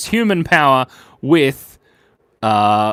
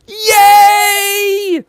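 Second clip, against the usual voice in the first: squeaky celebration voice; 9 dB louder, much slower than usual. Acted. Celebrates hitting 300 likes.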